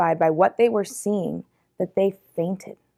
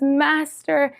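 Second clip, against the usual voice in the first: high, acted cry of recognition; overcome exclamation; 8 semitones higher, 3 dB louder than usual. A narrator's reading. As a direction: call out to him, voice lifted high. Mary recognizes Jesus, falls down.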